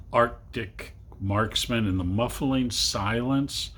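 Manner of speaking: plain reading voice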